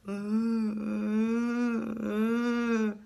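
long, droning imitated ghost wail; horror-film ghost crying. Storyteller imitating crying from ghost films, drawn-out eerie wail. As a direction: hold a drawn-out ghostly moan. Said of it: "những cái tiếng khóc trong phim ma đó".

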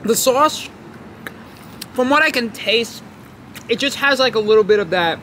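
chewing while talking; talking between bites of burger; mouth partly full. Real, not acted. Seen 1: bit burger at -3s; lips pursed, jaw working while talking.

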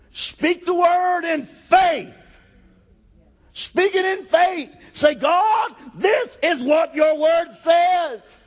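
high, loud, shouting preacher; full-force preaching. Acted. Pastor driving home faith to the church, full-force preaching. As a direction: preach it hard to the congregation.

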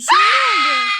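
loud, high crying shout; panicked crying yell; terrified cry. Real, not acted. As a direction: scream it out in real terror. Terrified, someone appears.

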